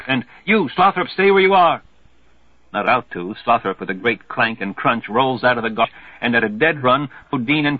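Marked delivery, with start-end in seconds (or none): 0.4-1.8 high, loud shout down the pier; hollering civilian. Acted. Civilian on the pier yelling at Slothrop, loud shout. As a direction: yell the order to stop him.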